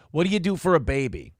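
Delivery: ordinary delivery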